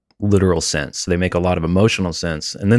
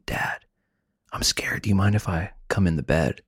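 put-on hushed, whispered request from a scared child; very soft, timid whisper; child's whisper; 8 dB quieter than usual. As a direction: imitate a kid whispering at the bedside. Father voices scared child at night.

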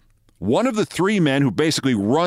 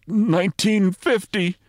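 slow, higher-pitched retort; spat-out answer; 7 semitones higher, slower than usual. A narrator's reading. Manager answering his colleague's question, spitting it out. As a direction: spit out each word, voice raised in pitch. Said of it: "Bavasi spit out the answer".

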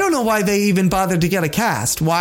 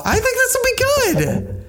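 mock squeaky insistence. Teasing insistence.

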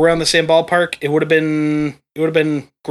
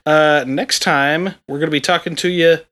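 usual speaking voice

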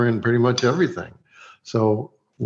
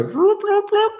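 very high electronic bloops; imitated recorder noise; slower than usual. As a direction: mimic a tape rewinding with high blips.

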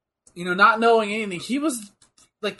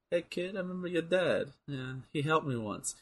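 Falcone; 10 dB quieter than usual. Acted. Mob boss meeting Bruce Wayne, quiet and cool. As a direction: drop the volume, casually cool mob boss. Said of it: "it's like Falcone in The Batman".

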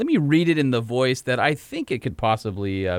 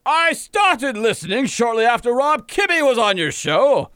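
blustery Southern rooster voice; 7 semitones higher than usual. Acted. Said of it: "are you Southern without the accent".

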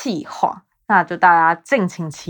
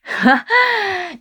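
sudden high giggle; high-pitched laugh; 12 semitones higher than usual. Real, not acted. Podcaster admitting her English has gotten worse, high laugh.

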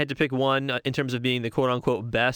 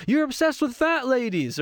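much higher, mock-exasperated movie line; Jeff Goldblum quote; 10 semitones higher than usual. Fan quoting the comeback, hammed-up Jeff Goldblum voice. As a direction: fire it back like a fed-up sidekick. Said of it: "And then Jeff Goldblum says".

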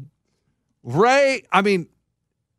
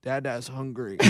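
put-on very soft, lower baby-talk whine; whiny drunk mumble; 7 semitones lower, 14 dB quieter than usual. Ray whining to his wife that he's hungry, soft baby-talk.

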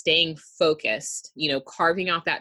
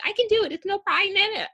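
put-on high, tiny kid voice; little girl voice; faster than usual. Mom retelling her daughter as a tiny girl, high kid voice. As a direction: squeak it out like a confident little girl. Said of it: "you would have this little".

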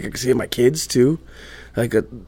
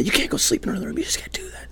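hushed, not a real scream; quietly imitating the screaming; retelling a yell under the breath; retold scream; 7 dB quieter, faster than usual. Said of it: "I wake her up screaming".

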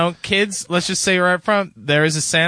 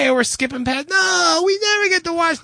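put-on parent telling kids; 12 semitones higher than usual. Parent skipping scene for kids.

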